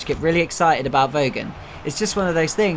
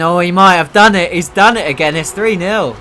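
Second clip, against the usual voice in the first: loud goal call over the crowd music; excited goal call; 3 semitones higher, 8 dB louder than usual. Real, not acted. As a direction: yell it as he scores. Goal called in live commentary.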